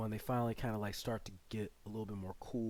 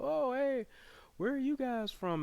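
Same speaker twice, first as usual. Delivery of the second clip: much higher, friendly driver asking questions; chatty driver voice. Retold or imitated. The mole driver quizzing Obi-Wan and Leia, high friendly voice. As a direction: pitch way up for a nosy driver. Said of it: "The driver, he's asking questions".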